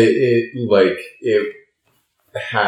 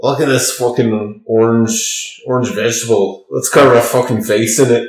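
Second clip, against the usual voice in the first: put-on Irish accent; 3 semitones higher, 4 dB louder than usual. Man playing an Irishman finding a pumpkin to carve, mock Irish accent. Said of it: "I gotta think of Conor McGregor".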